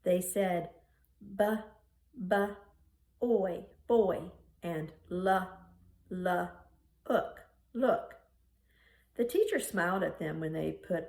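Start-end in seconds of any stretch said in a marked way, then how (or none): 1.3-8.2 put-on B, b, oy, boy sounded out slowly like first graders; sounding out letters. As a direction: spell each sound, then blend into the word. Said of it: "sounding out letters and words".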